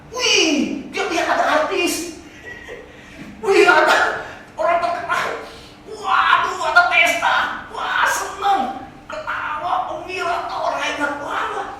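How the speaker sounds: preacher gushing about the celebrities at hell's party; excited. Acted. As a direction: gush over the party like a starstruck fan.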